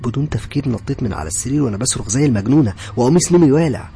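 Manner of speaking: high, loud, panicked narration; frantic alarm. A narrator's reading. Woman wakes screaming in a burning bed, frantic alarm. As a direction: tell it at the edge of screaming.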